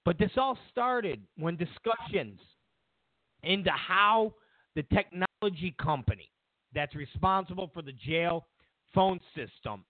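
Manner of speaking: usual speaking voice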